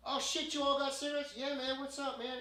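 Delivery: high, loud, thrilled street guy; excited surprise. Acted. Homeless man hearing outreach has cigarettes, excited surprise. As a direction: act out a sudden eager welcome.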